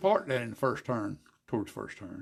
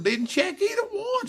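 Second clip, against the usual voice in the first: words squeezed out through laughter; can barely get it out for laughing; 4 dB louder than usual. Real, not acted. Telling the old racing story, laughing through the words. Laughing while retelling the scene.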